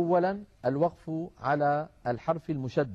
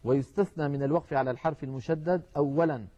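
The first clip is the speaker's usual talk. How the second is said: ordinary talk, nothing marked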